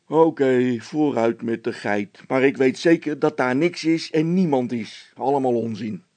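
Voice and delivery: a policeman brushing it off; gruff officer. A narrator's reading. (stoere veldwachter, wuift het weg)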